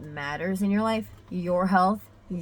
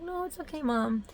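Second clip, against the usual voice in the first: put-on child comforting mom; 9 semitones higher than usual. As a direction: imitate a daughter gently soothing her mother. Daughter comforts her crying mother.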